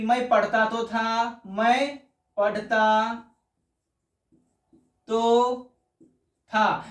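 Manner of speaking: "मैं पढ़ता तो था" slowed, a pause before तो; slow emphatic past. Shown as a demonstration. Teacher dictating the emphatic past tense, slowly. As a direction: drag the line, set तो apart. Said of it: "ये क्या हो गया".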